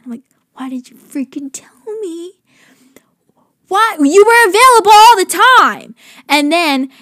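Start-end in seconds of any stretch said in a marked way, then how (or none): 0.5-2.3 hissed under the breath, much softer; a fed-up aside whispered to the camera; exasperated whisper. Retold or imitated. Exasperated inner reaction to client. 3.7-5.9 higher and louder, incredulous; playful outrage at a client who lied about availability; mock exasperated outburst.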